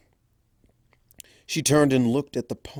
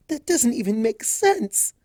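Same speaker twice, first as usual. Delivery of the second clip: indignant; 12 semitones higher than usual. A narrator's reading. Fluttershy protesting.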